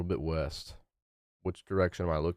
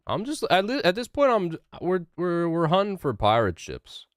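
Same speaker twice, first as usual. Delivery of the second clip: excited; 9 semitones higher, 4 dB louder than usual. Real, not acted. Excited announcement while gaming.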